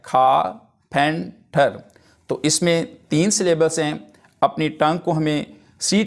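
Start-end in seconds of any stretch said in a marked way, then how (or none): 0.0-1.8 खाफेंटर said very slowly, syllable by syllable, खा-फें-टर; slow syllable-by-syllable demo of खाफेंटर; split into syllables. Shown as a demonstration. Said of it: "अब इसको मैंने नीचे divide भी किया है".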